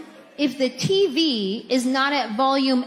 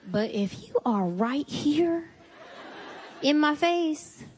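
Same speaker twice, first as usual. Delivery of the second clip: ordinary talk, nothing marked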